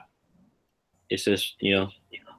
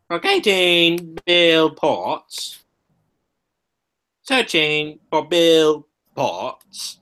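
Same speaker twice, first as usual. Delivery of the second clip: slow, higher robot computer voice; robotic voice; 5 semitones higher, much slower than usual. Pretend. Jokingly imitating a robot voice from Doctor Who, slow. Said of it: "sounds like I'm on Daft Punk".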